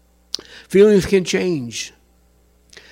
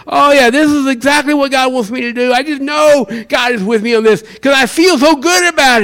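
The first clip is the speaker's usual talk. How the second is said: overexcited; 7 semitones higher, 5 dB louder than usual. Retold or imitated. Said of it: "and say".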